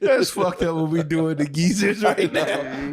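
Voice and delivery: high, slow, laughing through the words. Real, not acted. Reacting to the prank videos, laughing. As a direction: wheeze it out while laughing. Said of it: "laughing comment".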